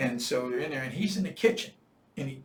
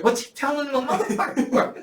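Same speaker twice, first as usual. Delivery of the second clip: higher, louder, laughing while quoting; laughing mock question; 11 semitones higher, 11 dB louder than usual. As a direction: ask it while laughing hard. Imitating the room, laughing.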